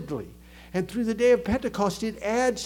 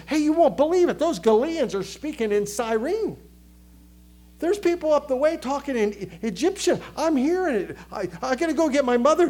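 higher, excited crowd voice; excited onlooker; 5 semitones higher, 3 dB louder than usual. Acted. Preacher voicing townsfolk spreading news of the Galileans, excited. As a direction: play an amazed witness rushing the news out.

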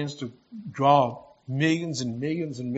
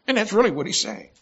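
emphatic; 7 semitones higher, 5 dB louder, faster than usual. Real, not acted. Preacher driving the point home.

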